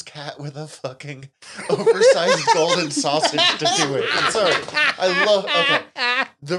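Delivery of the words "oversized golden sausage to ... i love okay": high, loud words through real laughter; cracking up. Real, not acted. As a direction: laugh helplessly while trying to finish. Cracks up while describing scene.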